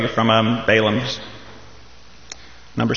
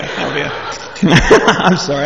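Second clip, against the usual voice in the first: laughing; slower than usual. Real, not acted. Host laughs mid-sentence.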